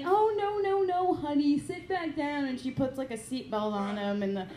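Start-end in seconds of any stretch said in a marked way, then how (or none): 0.0-2.4 higher, mock reassuring; mother calming her son mid-scene; soothing mom. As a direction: coax the son back down, sweetly. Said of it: "to be like".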